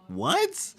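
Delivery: high squeaky disbelieving what; incredulous. Real, not acted. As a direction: shriek it in disbelief. Baffled by the song.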